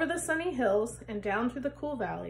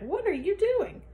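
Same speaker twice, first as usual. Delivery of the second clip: put-on high, loud fussing old woman; scolding old woman; 6 semitones higher, 3 dB louder than usual. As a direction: fuss at him in a raised voice. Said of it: "she cried".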